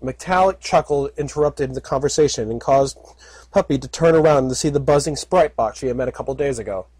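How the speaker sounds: usual speaking voice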